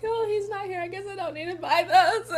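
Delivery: half-laughing, half-crying memory of the shrimp aisle; wistful inner voice. Retold or imitated. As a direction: voice the sad thought with a laugh. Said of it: "and I'm like".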